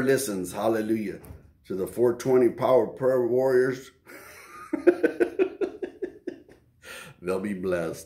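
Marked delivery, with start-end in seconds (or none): 4.6-6.3 high breathless laugh; a quick airy giggle; real laugh. Real, not acted. Preacher laughing while naming the prayer group, airy giggle.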